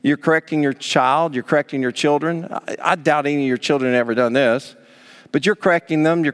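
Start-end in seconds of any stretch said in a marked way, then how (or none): none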